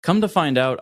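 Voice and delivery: normal talking voice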